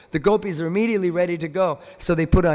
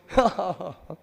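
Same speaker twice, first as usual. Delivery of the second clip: short acted belly laugh; laugh out loud. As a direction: imitate someone laughing out loud. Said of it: "physically laughed out loud".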